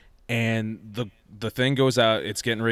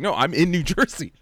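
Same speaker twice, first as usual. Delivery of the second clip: higher, exasperated "No, I'm in New Jersey"; exasperated repeat; 8 semitones higher than usual. Retold or imitated. Repeating himself to his confused mom, exasperated. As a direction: spell it out again, fed up. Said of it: "It's like".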